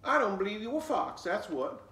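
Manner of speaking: put-on little girl voice. Flossie challenging the fox, loud and sassy. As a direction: sass him like a bold little girl.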